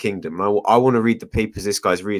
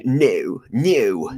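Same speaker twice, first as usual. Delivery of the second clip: lightly imitated posh British "no". S1 mocks the Oxford guy's "no," lightly posh. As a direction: drawl out "no" like an Oxford toff. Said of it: "The way he talked, the, just the word "no".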